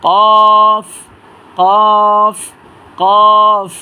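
কফ heavy from the tongue root, loud; thick, drawn out. Shown as a demonstration. Teacher models a letter for learners, loud, thick and long. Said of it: "তিন আলিফ টেনে এবং মোটা আওয়াজে".

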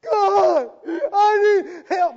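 high, drawn-out mock wail to God; mock desperate prayer. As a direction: shout a hammed-up plea, very loud. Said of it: "You ever see people when they pray sometimes?".

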